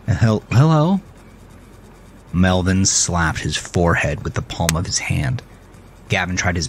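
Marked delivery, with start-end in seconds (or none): 0.1-1.0 nervous broken hello; stammering. A narrator's reading. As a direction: trip over the first word. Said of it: "Gavin managed to stammer".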